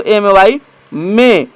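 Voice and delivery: "एमएवाई में" drawn out slowly, ending on "में"; धीमा उच्चारण; slow model word. Shown as a demonstration. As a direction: say "में" slowly and clearly for students. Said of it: "इसी प्रकार से उच्चारण किया जाएगा".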